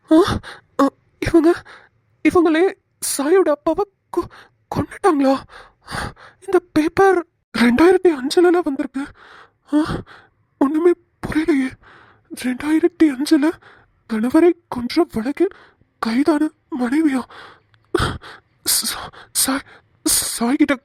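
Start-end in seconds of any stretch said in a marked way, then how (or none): 0.1-17.2 stunned disbelief. Acted. Reads shocking news about Sai. 18.7-19.7 stunned whisper. Acted. Stunned after reading news.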